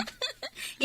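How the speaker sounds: a voiceless, stifled giggle; breathless giggle. Acted. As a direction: giggle under your breath. Laughs at boss's reaction.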